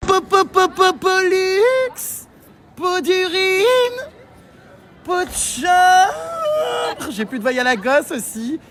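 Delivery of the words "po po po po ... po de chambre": announcer build-up. Pretend. Announcing guests, drawn-out wordplay.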